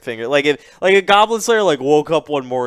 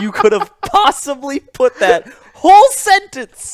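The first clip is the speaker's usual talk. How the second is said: laughing; 7 dB louder than usual. Real, not acted. Still laughing, high pitch.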